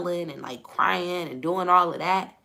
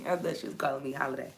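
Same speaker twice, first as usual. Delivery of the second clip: ordinary talk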